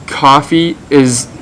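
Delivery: normal talking voice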